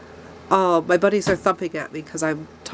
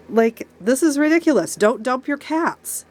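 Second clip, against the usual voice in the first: higher, deliberate indignant scolding; 6 semitones higher than usual. Real, not acted. Angry plea against dumping cats.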